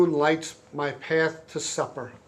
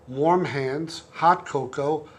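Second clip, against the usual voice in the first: usual speaking voice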